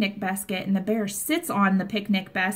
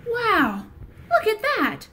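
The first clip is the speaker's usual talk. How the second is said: storybook wonder; 2 semitones higher than usual. Acted. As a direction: gasp at the sight for the children. Reads story to children.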